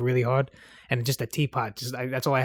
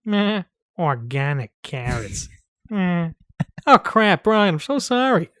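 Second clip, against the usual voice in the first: cartoon dad, jumping up higher; Peter Griffin impression; 4 semitones higher, slower than usual. Co-host tries lines as cartoon dad apologizing to Brian, hammed-up impression. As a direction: blurt it like a bumbling cartoon husband. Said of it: "Do you have a Peter Griffin impersonation?".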